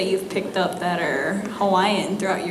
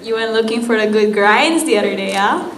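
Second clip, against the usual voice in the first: quick, loud pidgin line with the rising yeah at the end; Hawaiian pidgin; 5 dB louder, faster than usual. Shown as a demonstration. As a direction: rattle it off like a Hawaii local. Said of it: "Okay, one more time".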